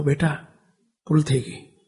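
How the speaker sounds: low, soft and humbled; soft apology. Retold or imitated. Father tells his feverish son he erred, soft and low. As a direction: concede the mistake gently to the boy.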